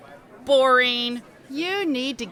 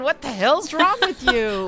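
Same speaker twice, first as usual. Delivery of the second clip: laughing; 4 semitones higher, 4 dB louder than usual. Acted. Patty laughing after fright.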